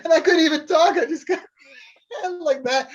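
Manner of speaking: can't stop giggling; real giggle fit while telling it; laughing. Real, not acted. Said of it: "I started laughing".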